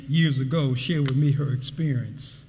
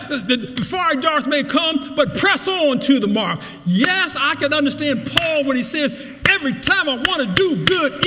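preaching at full force; 7 semitones higher than usual. Acted. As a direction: preach it with fire.

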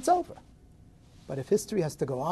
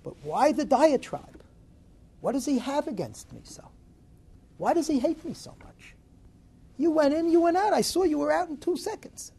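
higher, pleading and hurt; a fired worker complaining, pitch climbing; upset fired worker; 8 semitones higher than usual. Retold or imitated. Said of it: "And Shimon starts to cry".

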